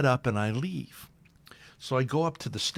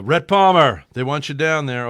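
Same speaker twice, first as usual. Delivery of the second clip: loud call across the stands; 4 semitones higher, 10 dB louder than usual. Retold or imitated. Guard calling the reporter down, loud. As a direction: call his name out loud.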